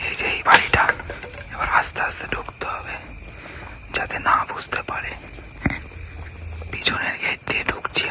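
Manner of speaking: sneaking whisper, no voice at all. Real, not acted. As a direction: whisper so the house doesn't hear. Sneaking into the house secretly.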